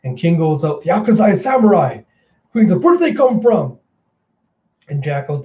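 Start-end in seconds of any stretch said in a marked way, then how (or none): 0.8-3.8 high, loud villainous surprise; retold alarm, pitched up; shocked exclamation. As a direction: cry out at an ambush.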